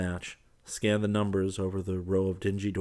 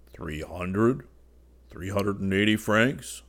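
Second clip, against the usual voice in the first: contrite; 2 semitones higher, 7 dB louder, slower than usual. Acted. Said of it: "with a scrim of contrition".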